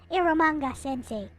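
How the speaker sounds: ordinary talk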